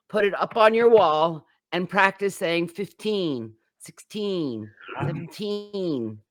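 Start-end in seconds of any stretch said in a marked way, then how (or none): 2.7-6.2 put-on fifteen, sixteen, seventeen with a drawn-out TEEN; teacher modelling the stressed final TEEN. Shown as a demonstration. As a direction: lean on "teen" and let it fall. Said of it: "and stress that teen".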